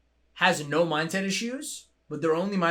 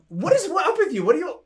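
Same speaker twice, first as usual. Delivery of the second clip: much higher and louder, baffled; exasperated disbelief; 12 semitones higher, 7 dB louder than usual. Retold or imitated. Asking his brother what's wrong with him, exasperated disbelief. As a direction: throw up your hands in disbelief.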